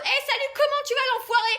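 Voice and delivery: high, loud jovial shout; loud cheeky greeting. Retold or imitated. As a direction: hail a stranger rowdily. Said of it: "je suis là en mode".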